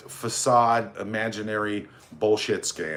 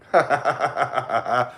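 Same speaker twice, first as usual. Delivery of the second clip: steady staccato ha-ha-ha; mocking laugh. Real, not acted. Host greeting his number one stalker, mocking laugh. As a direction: laugh derisively in a run of quick beats.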